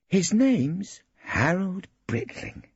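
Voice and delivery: put-on high, slow, matter-of-fact girl; flat young woman. A narrator's reading. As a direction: settle the matter. Pauline speaks at last.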